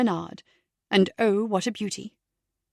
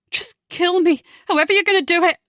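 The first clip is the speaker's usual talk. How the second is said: higher, louder exasperated plea; despairing outburst; 8 semitones higher, 6 dB louder than usual. Acted. Rebecca telling her captor to get it over with, higher and louder. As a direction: fling it at him, fed up.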